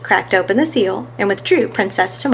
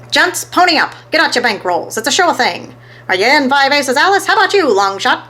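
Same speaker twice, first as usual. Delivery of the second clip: higher, louder hustler pitch; rallying bettors; 6 semitones higher, 3 dB louder than usual. A narrator's reading. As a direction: rope everyone in.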